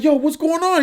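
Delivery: comic high-voiced buddy; high mock friend voice. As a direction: squeak it out like a startled inmate. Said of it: "they're like".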